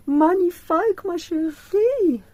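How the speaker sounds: high, loud, drawn-out gushing praise; hammed-up diva purring magnifique; grand gushing compliment. Admiring her painting, she gushes like a grand diva, loud and slow.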